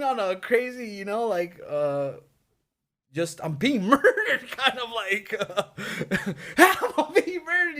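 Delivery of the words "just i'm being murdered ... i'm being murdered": high laughing voice through the words. Real, not acted. Retelling his sleep screams, laughing high. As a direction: laugh while acting out the panic.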